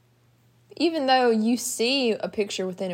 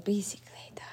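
whispering; 10 dB quieter than usual. Real, not acted. Whispering a word.

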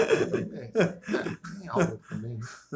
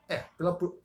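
ordinary delivery

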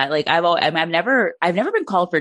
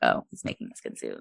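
much lower, soft flat aside; deadpan mock realization; 9 dB quieter than usual. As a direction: say it like it's obvious and grim. Mock discovery in show.

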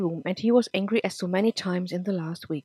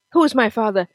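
demanding question; 3 semitones higher, 9 dB louder than usual. Acted. Nick demands to know father.

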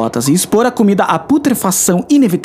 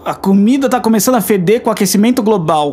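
normal talking voice

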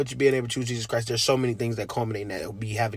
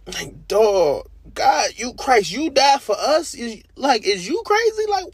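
much higher and louder, almost cracking; overwhelmed disbelief; 10 semitones higher, 6 dB louder, slower than usual. Real, not acted. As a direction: cry out in amazed wonder. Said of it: "addressing God in disbelief".

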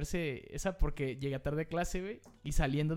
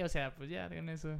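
ordinary talk

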